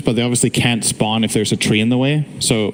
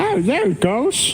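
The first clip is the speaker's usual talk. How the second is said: put-on high, slow, creaky old man; 14 semitones higher, much slower than usual. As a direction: drag it out like a frail old man. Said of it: "that your old man voice?".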